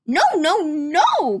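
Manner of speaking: high, loud excited denial; cried. Acted. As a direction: protest it eagerly. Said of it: "cried the prince".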